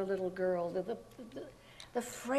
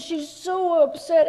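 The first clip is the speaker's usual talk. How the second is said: loud, slow, high mock fuss; hammy drunk diva; 7 semitones higher, 9 dB louder, slower than usual. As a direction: coo over her loudly like a tipsy star. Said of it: "So she says".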